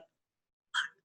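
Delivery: breathy whispered swear; mock dismay; whispered curse. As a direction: hiss it under your breath. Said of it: "I'm like".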